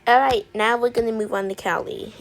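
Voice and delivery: ordinary talk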